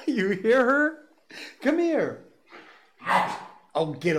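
very high coaxing pet voice; dog talk. Real, not acted. Host calls the dog for a cookie, excited high dog talk. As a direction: coax a dog in with a high-pitched call.

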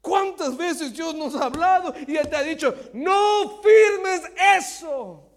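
high, very loud drawn-out shout. Shown as a demonstration. Preacher showing how God does not warn, shouted. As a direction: roar the warning out. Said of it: "Pero no está gritando".

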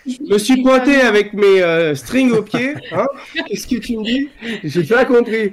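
high-pitched comic bewilderment; mock confusion. Joking about turning up in thongs, mock confusion. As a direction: play the baffled misunderstanding, voice pitched high.

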